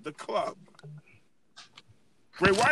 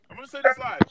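repeated line said louder for emphasis; louder declaration; 7 semitones higher, 6 dB louder, faster than usual. Real, not acted. Host making an on-air vow louder. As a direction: announce it louder for the record.

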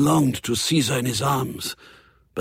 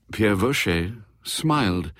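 plain reading voice

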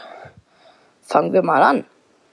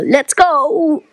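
excited; 6 semitones higher, 3 dB louder than usual. Real, not acted. Starting another round.

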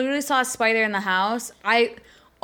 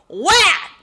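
loud, much higher imitated slap sound; mock whack; 9 semitones higher, 7 dB louder than usual. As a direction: blurt the smack sound loud and high. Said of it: "And he goes".

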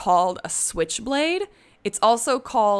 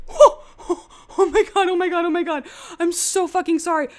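high shrieking, laughing panic; startled shriek; 11 semitones higher, 5 dB louder, faster than usual. Real, not acted. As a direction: shriek and laugh in real shock. Knife accidentally hit the microphone.

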